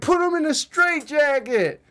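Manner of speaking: a hint of a yelling fan; mock heckler. Retelling a fan heckling Tyson, mock yell. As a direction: toss it off like a heckler. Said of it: "some dude was like".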